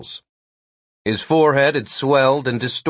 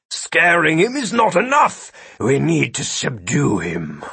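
monster voice; 4 semitones higher than usual. A narrator's reading. Gilbert speaks in dialogue.

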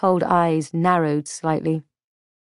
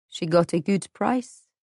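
flat. A narrator's reading. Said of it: "she said flatly".

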